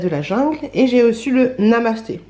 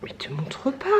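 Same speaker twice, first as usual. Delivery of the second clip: whisper; 6 semitones lower, 11 dB quieter than usual. Real, not acted. Aside to baby while filming.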